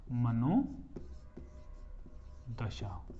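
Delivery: मनोनुभूति stretched out syllable by syllable; slow drawn-out drill. Shown as a demonstration. Teacher spells out the word for students. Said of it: "teacher states the result".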